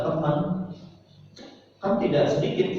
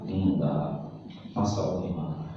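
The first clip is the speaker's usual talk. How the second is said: a soft, lower, gentle mother's voice; tender mother; 7 semitones lower, 6 dB quieter than usual. Retold or imitated. Mother welcomes her tired son home, soft and tender. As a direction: soothe him like a loving mum.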